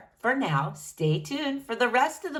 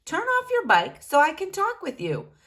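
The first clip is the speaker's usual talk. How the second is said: high, louder request; exasperated Frank; 8 semitones higher, 3 dB louder than usual. A narrator's reading. Frank asking Bean to turn off his bike, high. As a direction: ask him firmly. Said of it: "says Frank".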